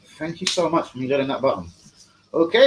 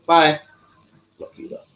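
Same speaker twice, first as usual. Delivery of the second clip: ordinary talk, nothing marked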